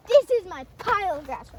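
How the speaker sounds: loud, high, proud announcement; excited reveal. Real, not acted. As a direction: show it off with a burst of excitement. Gasp then proud reveal.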